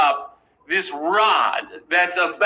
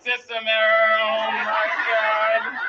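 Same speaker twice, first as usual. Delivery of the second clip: robot voice; 6 semitones higher, slower than usual. Pretend. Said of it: "Even if I were a robot".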